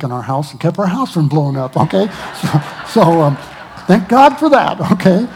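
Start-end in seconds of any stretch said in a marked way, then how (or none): 1.8-5.3 loud, high burst of thanks; rising to a joyful, loud peak; excited. Real, not acted. Praising God loudly to the church for the saved house, excited.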